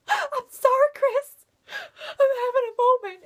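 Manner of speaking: a starstruck fan wailing through tears; tearful outburst. Retold or imitated. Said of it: "And I'm like".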